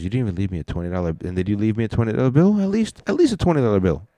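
fast rant climbing to a high, loud outraged squeal; mock indignant housekeeper. Housekeeper complains about guests leaving no tip, fast mock rant rising to a loud, high squeal. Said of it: "She goes".

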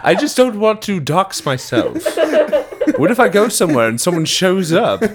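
high villain voice breaking into laughter; Pegasus. Acted. Excusing himself from saying where, laughing Pegasus impression. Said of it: "You do Pegasus".

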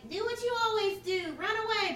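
high, sing-song mock lecture; mocking trope voice. Mocking the annoying trope line, high and sing-song. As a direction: parody the cliche line in a high voice. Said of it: "that annoying trope where it's like".

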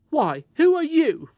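high, loud, drawn-out affront; offended. Acted. Nurse challenging the boy's free manner, offended. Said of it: "offended at the freedom with which he spoke to them".

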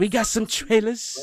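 high, loud Boston-style echo; mock Boston accent. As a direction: bounce it back in a jokey Boston accent. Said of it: "Your cousin from Boston".

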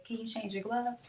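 softly asking him to change gloves; quiet, calm ask. Retold or imitated. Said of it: "in a voice just like this".

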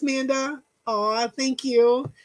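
normal talking voice

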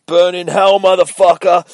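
loud, high, full-force shout; battle yell. Acted. Soldier throws a grenade at his enemy, furious yell. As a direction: roar it as you hurl a grenade.

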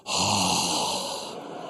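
long low defeated sigh; deflated sigh. Real, not acted. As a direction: let the air out, beaten. Deflated after son's reply.

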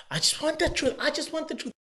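high pleading girlfriend voice, a mock imitation; girlfriend begging for the truth. Girlfriend in a video begs her man for the truth, high and pleading. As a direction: beg like the girl in the clip, way up high. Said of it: "the girl's like".

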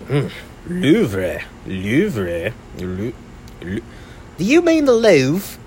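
puzzled Brit, leaping high on the question; bad British accent. British guy puzzling over a tourist's question, mock British accent. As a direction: imitate a baffled British man, badly. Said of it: "that was a British guy".

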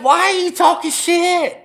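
mock her with a high, slow huffy comeback; incredulous retort. Retelling a fight with his girlfriend, high slow indignant retort. Confronting girlfriend in retold argument.

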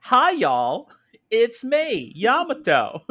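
high, loud Southern belle drawl; Yamato. Acted. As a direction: greet folks as a Southern belle. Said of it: "I don't know why she has an accent with me".

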